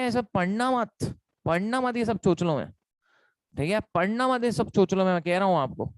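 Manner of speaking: usual speaking voice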